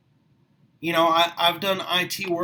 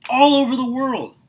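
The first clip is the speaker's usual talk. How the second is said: higher and louder, carried away; passionate outburst; 7 semitones higher, 8 dB louder than usual. Real, not acted. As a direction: let it burst out with fervour. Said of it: "My voice gets louder and it gets higher".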